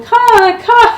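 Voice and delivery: high, loud cawing like a raven; playful raven imitation. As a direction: call out like a crow. Teller imitates a raven's call.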